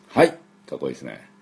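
ordinary talk, nothing marked

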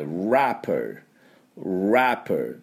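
"wrapper" with silent W, English R, falling; English pronunciation. Shown as a demonstration. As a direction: say "wrapper" like rap music, no W. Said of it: "発音はですね".

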